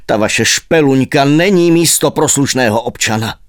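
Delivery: ordinary delivery, nothing marked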